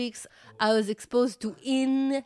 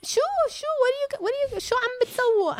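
much higher, shrill shooing; janitor shooing; much faster than usual. Retold or imitated. Janitor lady shooing the mom away, much higher. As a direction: shoo them away like stray cats. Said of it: "she's like".

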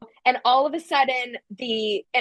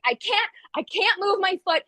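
frightened plea; 8 semitones higher than usual. Retold or imitated. Boyfriend frozen with fear.

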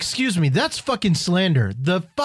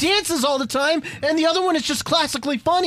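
high-pitched, insistent rebuttal; indignant protest; 10 semitones higher than usual. Real, not acted. Fan defending the Shining Stars, indignant. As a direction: argue back with voice shooting up. Heated defence of favourite team.

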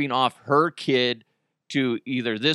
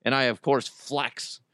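normal talking voice

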